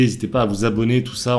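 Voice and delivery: usual speaking voice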